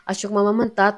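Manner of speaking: ordinary delivery, nothing marked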